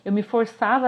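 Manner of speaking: usual speaking voice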